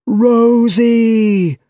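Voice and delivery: high, loud, long cry of a name; shouting. A narrator's reading. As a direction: cry out for a fallen wife. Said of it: "shouted Otto".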